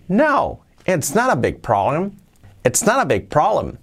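"No, it's not a big problem" with a lively rise and fall; with intonation. Shown as a demonstration. Teacher modelling natural intonation for students. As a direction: give it real reassuring tune, not a drill. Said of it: "le damos entonación".

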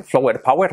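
flower power read as spelled, "flo-wer pa-wer"; Spanish-style flower power with an oh; wrong pronunciation. Shown as a demonstration. (flower power mal dicho, a la española)

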